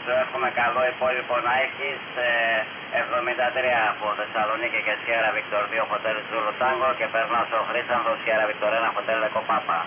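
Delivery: usual speaking voice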